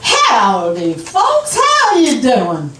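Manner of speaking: drawn-out Southern greeting, pitch jumping up on "folks"; put-on Southern dialect. Shown as a demonstration. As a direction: greet the crowd in a slow Southern accent. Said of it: "I do a really good Southern dialect".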